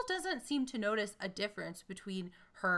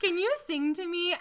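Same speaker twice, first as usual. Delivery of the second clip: high, loud lovestruck plea; hammed-up princess echo; mock swooning Rapunzel; 11 semitones higher, 10 dB louder than usual. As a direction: beg him sweetly to sing. Echoes mock Rapunzel line.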